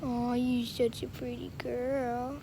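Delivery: usual speaking voice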